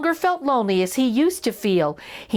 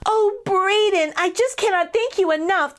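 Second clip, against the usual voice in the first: grateful mouse; 10 semitones higher than usual. A narrator's reading. Elvin thanking Braden at Connor's door, high and grateful. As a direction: gush your thanks.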